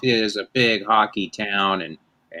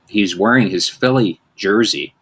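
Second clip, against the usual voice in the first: ordinary delivery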